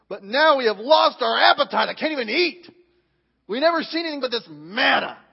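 hammed-up exasperation, punching the key words; aggravated. Preacher rereading the verse as fed-up Israelites, aggravated. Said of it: "why are you so aggravated about that".

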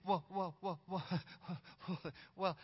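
stalling, stuttering mock nerves; nervous stammer. Scared advisor stalls before little king, nervous stammer. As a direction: play a shaking advisor stuck on one word. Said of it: "they were kinda shaking a little bit".

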